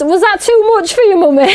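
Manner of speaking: put-on high, laughing cheeky kid voice; son laughing. Son teasing mum after dodging her kiss, laughing. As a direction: tease mum through a laugh. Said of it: "He goes".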